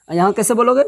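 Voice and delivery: normal talking voice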